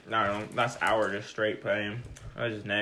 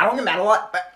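hammed-up upset teammate, higher and fast; Jesse impression; 5 semitones higher, 3 dB louder than usual. Friend retells Jesse's complaint on the court, mock higher and fast. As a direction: play a friend insisting he never gets mad, half laughing. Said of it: "Your face is pretty spot on".

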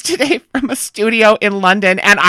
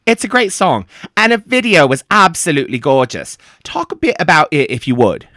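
put-on slipping into a lower British accent; imitating the singer's British accent; 4 semitones lower than usual. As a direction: copy the British guest's accent unawares. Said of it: "Why are you talking like that?".

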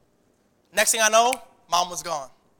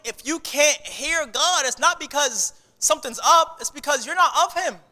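normal talking voice

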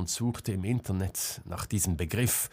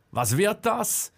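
high, drawn-out call; calling after; 11 semitones higher, 4 dB louder than usual. A narrator's reading. Marcel calling after Selma as she leaves, shouted question. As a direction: call after someone walking off. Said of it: "rief ihr Marcel hinterher".

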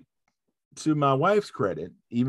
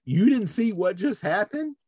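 higher, mildly raised voice of disbelief; retold louder-than-needed question; 8 semitones higher than usual. Husband asks his oblivious wife what she saw, mildly raised voice. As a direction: imitate yourself asking a touch too loudly. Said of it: "said probably louder than I probably needed to be".

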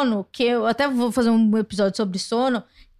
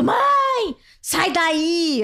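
high, stretched cry of "mãe"; mock yell, pitch leaping up then falling; re-enacted sleep shout; 5 semitones higher, much slower than usual. Woman re-enacting her nightmare scream to her mother, shouting. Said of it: "Daí eu grito".

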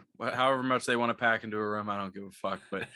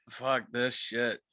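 slow drawn-out curse; much slower than usual. Retold or imitated. Narrator quoting himself bailing, slow drawn-out curse. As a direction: stretch out "Fuck this shit" slowly, each word dragged. Fleeing hotel, repeating the curse.

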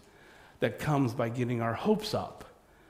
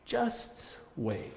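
soft hushed "just wait"; a calm Jesus quietly holding them back; 8 dB quieter than usual. Acted. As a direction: hush them gently, almost unvoiced. Jesus voiced telling disciples.

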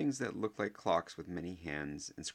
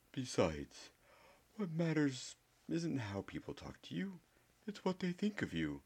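playful reassurance from an eccentric headmaster; Dumbledore's voice; 2 semitones higher than usual. A narrator's reading.

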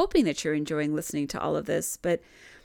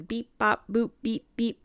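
higher, louder playful beep-boop noises; robotic space beeps; 5 semitones higher, 4 dB louder than usual. Retold or imitated. Telling listeners how Seon sounds, playful robotic beeping. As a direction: mimic a little robot tuning in. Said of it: "you know, space type noises".

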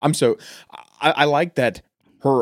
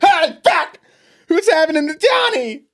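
country scream; 8 dB louder than usual. Pretend. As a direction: scream it out in a twangy panic. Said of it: "turned to the right and screamed".

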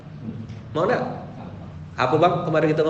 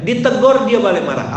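ordinary talk, nothing marked

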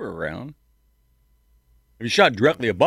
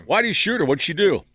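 high, loud shocked question; 9 semitones higher, 3 dB louder than usual. Real, not acted. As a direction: blurt it out in disbelief.

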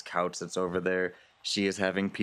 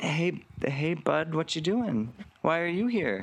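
higher, slowed alarmed hey bud; startled Veronica; 8 semitones higher than usual. Acted. Veronica spotting Lance across the room, higher and startled. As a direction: caught off guard by a familiar face. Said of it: "She goes".

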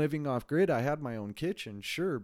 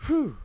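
a high, loud exhale of relief; high whew; 14 semitones higher, 5 dB louder than usual. Real, not acted. Winding down after his city rant, high whew. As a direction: blow out the rant. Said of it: "So that's my little rant".

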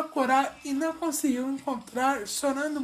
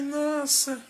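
ordinary talk

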